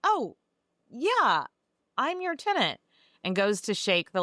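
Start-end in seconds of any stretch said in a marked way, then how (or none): 0.0-2.8 high, startled little voice as the eager tenant; flustered Lockwood. Retold or imitated. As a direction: play the tenant caught off guard, pitched up. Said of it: "And Lockwood is like".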